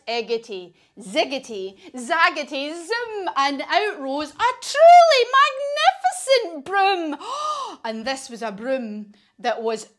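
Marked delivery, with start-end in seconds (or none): 0.1-3.3 witch's spell. Acted. Witch utters magic words over cauldron, rising incantation. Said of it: "her magic words". 3.4-7.2 high, loud, building announcement; awed excitement. A narrator's reading. Storyteller reveals the magic broom, soaring wonder. 7.2-7.8 high theatrical gasp; amazed gasp. Acted. Storyteller sees the magnificent broom, amazed gasp.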